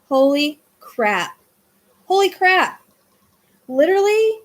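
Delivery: holy crap, that's right there; shocked excitement. Real, not acted. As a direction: blurt it out in real shock. Realizes the street is nearby.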